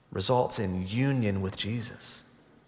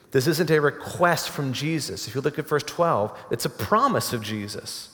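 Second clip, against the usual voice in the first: ordinary talk, nothing marked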